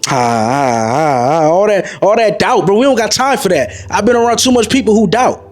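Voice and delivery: ordinary talk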